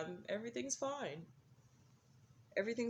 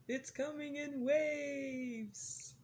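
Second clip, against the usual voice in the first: mock dramatic; 8 semitones higher, 4 dB louder, much slower than usual. Dramatic wave comparison.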